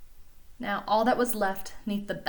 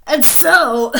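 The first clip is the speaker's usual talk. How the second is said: Lorax voice; 5 semitones higher, 13 dB louder than usual. Acted. Lorax voice resumes.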